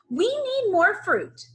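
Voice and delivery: high character voice for Jim; lightly voiced Jim, urgent; Jim asking. Jim's line in story.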